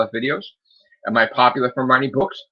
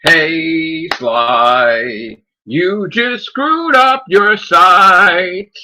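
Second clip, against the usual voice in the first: loud, slow, drawn-out taunt; taunting call-out; 6 semitones higher, 7 dB louder, much slower than usual. Acted. Streamer calling out Sly for ruining the site, taunting call-out. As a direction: stretch each word into a loud call.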